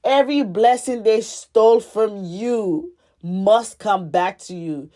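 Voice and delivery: usual speaking voice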